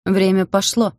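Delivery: an enraged master barking the order; barked command. A narrator's reading. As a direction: snap the start of the hunt. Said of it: "гаркнул вышедший из дома обозлённый Игнат".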